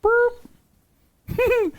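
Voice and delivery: very high cooing. Real, not acted. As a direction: coo up high at the frog. Cooing at frog.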